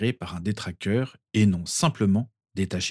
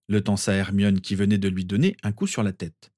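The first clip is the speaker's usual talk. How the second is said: ordinary delivery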